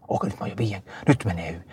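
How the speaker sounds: hushed whisper to himself mid-race; whispered thought. Retold or imitated. A skier whispering his own race position, hushed. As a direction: whisper it as a private disbelieving thought.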